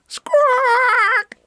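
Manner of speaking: one long, piercing squawk; very high, loud bird cry; parrot squawk. Acted. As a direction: screech like a noisy parrot. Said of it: "Pedro's squawks".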